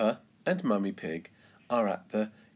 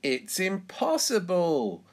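high, swooping exclamation; pitched-up, merry disbelief; amused scoff; 8 semitones higher, 4 dB louder than usual. A narrator's reading. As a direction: crow it as if the idea is laughable. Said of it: "laughs Miss Rabbit".

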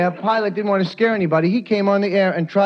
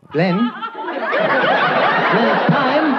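polite English wake-up; 6 semitones higher, much slower than usual. Retold or imitated. English hotel man waking him.

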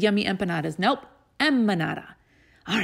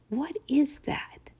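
whisper; 6 semitones higher, slower than usual. Real, not acted. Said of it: "teacher whispering to class".

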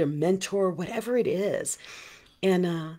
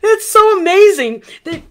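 much higher and much louder all at once; excited burst; 13 semitones higher, 16 dB louder than usual. Real, not acted. Host gushing to her group about connecting, excited. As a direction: erupt with delight.

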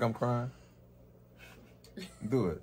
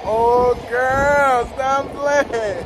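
high and loud, mock-flattered; playful sass; 10 semitones higher, 11 dB louder, much slower than usual. Real, not acted. Rapper answering praise for his haircut, high sassy squeal.

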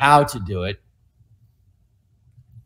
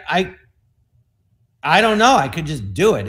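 ordinary talk, nothing marked

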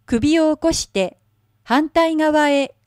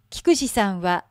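plain reading voice

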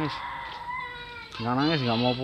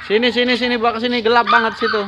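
loud, raised call to come here; calling over; 10 semitones higher, 5 dB louder than usual. Real, not acted. Vlogger calling the kids over in the dark, loud beckoning call. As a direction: beckon them over from a distance.

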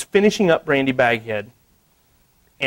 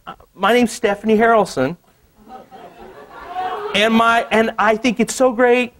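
higher, lightly voiced young woman; Stephanie's message; 8 semitones higher than usual. Woman writing to the artist, lightly voiced sweetness. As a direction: read her note in a lightly sweet higher voice. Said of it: "she goes".

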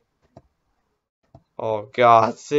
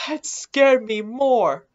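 high, slow, drawn-out complaint of fright; pitched-up, emphatic slow protest; shaken insistence; 9 semitones higher, slower than usual. Real, not acted. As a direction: repeat it higher and slower, still rattled.

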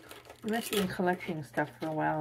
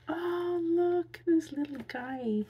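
excited squeal; 10 semitones higher, 5 dB louder, slower than usual. Real, not acted. Gushing over a cute plush.

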